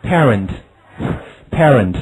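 very slow, careful repetition; slow model reading. Shown as a demonstration. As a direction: say "Parent" slowly twice. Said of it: "好，下面".

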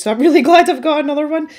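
ordinary talk, nothing marked

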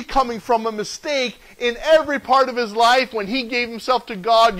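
ordinary talk

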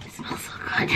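soft whispered delight. Real, not acted. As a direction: hush it, savouring the scent. Smelling the scented letter.

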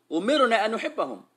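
ordinary talk

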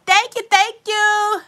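slow, high, loud overjoyed thank yous; gushing thanks. Real, not acted. Streamer thanks a fan for the super chat, high, loud and slow. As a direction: stretch each thank you out in delight.

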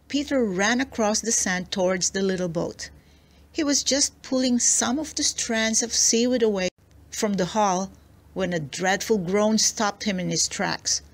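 ordinary delivery, nothing marked

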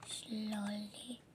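soft unvoiced whisper; sneaking whisper. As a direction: whisper so nobody hears. Sneaking out with stolen snacks.

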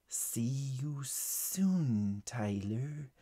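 slow, hushed serpent farewell; snake voice. A narrator's reading. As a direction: let the snake's words slide out softly. Snake character says goodbye.

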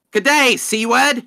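lightly mocking Australian greeting. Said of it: "Australians just greet them".

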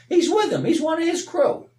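high, loud accusing bystander; mock accuser. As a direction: jab it at Peter like a gossip. Mocking accusers, voiced high.

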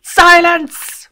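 very high, very loud bellowed "Silence!"; furious shout. Acted. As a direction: roar him into silence. Overlord shouts the last one down.

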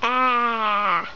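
low, half-hearted "ah"; barely bother to scream; weak scream. Pretend. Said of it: "that is such a weak scream".

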